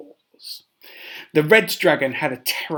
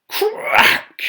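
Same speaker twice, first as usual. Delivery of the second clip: crack sound effect; 8 dB louder than usual. Acted.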